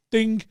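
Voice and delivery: imitated ding. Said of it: "imitated bell".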